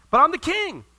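lightly voiced king pulling rank; hammed-up royal indignation; indignant king. Ahab argues with Naboth.

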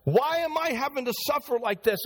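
lightly voiced prisoner protesting his innocence; Joseph complaining. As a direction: Joseph pleading from jail, a touch aggrieved. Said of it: "That's what Joseph was saying".

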